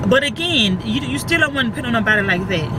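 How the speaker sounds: ordinary talk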